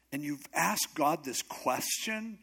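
ordinary talk

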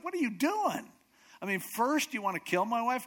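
high, loud confrontation; acted indignant scolding; outraged king.

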